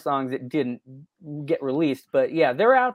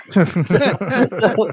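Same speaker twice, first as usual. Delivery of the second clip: laughing; 5 semitones higher, 6 dB louder than usual. Real, not acted. Laughing after recalling Greg's reply.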